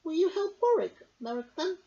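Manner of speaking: high, chirpy bird voice; bird Crick. A narrator's reading. Crick asking the wise man, high and chirpy. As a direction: ask eagerly like a little bird. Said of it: "Crick asked".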